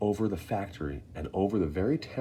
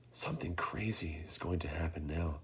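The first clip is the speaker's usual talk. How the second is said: inner thought; 3 semitones lower, 11 dB quieter than usual. A narrator's reading. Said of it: "Charlie thought".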